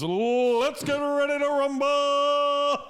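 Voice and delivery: long drawn-out booming announcer call; ring announcer. Retold or imitated. Mocking the fight at the crisis centre, booming announcer call. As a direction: stretch it out like a boxing announcer.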